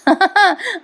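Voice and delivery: short high laugh; amused chuckle. Real, not acted. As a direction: giggle at the joke. Narrator chuckles at father's joke.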